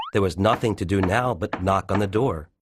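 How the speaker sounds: ordinary delivery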